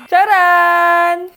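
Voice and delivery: loud, showy ta-da; excited reveal. Acted. Vlogger unboxes the phone for followers, loud excited reveal. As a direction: belt out the big unveiling.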